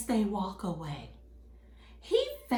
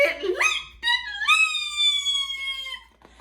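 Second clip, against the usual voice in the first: high singsong, climbing higher with each repeat; suspenseful storytelling; 9 dB louder, slower than usual. A narrator's reading. Storyteller following one little flame as it leaps, excited. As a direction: build excitement in small leaping bursts.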